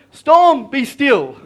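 commanding. Retold or imitated. Jesus speaks to the storm, commanding. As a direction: order a storm to settle. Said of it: "he says to the storm".

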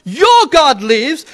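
disillusioned widow, edged "your"; bitter doubt. Shown as a demonstration. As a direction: say it as someone who no longer believes. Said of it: "seen too much sickness and death".

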